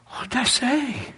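astonished. Retold or imitated. Preacher asks, astonished.

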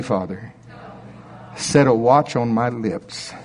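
slow, prayerful call and response; led prayer. Real, not acted. Preacher leading the church in repentance: slow prayer. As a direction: lead them gently into prayer.

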